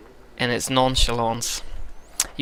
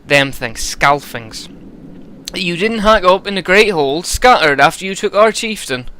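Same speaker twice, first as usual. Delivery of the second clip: higher, louder West Country steward; proud steward in a thick West Country accent; West Country Eirik; 6 semitones higher, 4 dB louder than usual. Acted. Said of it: "I think West Country for this guy".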